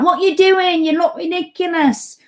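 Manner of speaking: high mocking jeer called out across the street; [name] shouting. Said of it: "she's shouting out".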